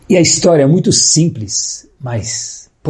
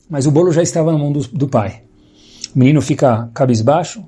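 normal talking voice

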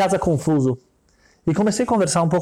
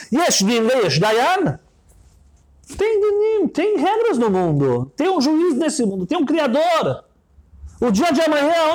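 put-on high, earnest girl's voice, rising to preaching; girl warning him; 5 semitones higher than usual. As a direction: warn him of judgment with fervor. Girl preaches at rich man.

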